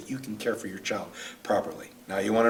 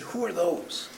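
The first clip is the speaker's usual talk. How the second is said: holding back emotion; 6 semitones higher, 5 dB quieter, slower than usual. Real, not acted. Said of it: "I get emotional with this one".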